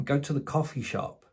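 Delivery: ordinary delivery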